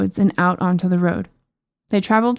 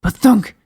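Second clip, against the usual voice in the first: booming thump; 2 semitones higher, 5 dB louder than usual. Acted. Footsteps sound effect.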